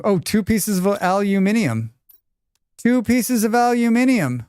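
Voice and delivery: high excited discovery; thrilled by the loot; excited find. Real, not acted.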